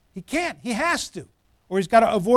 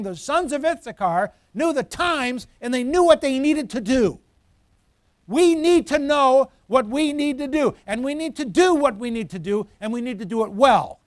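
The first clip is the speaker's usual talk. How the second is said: raised, higher preaching voice; forceful preaching; 4 semitones higher, 4 dB louder than usual. Real, not acted. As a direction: drive it home to the congregation.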